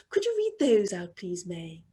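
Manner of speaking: usual speaking voice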